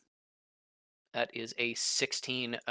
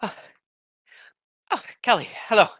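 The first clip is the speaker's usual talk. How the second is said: breathless, panting greeting; huffing after a rush; out of breath; 11 semitones higher, 12 dB louder, much faster than usual. Acted. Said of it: "a little bit out of breath and goes".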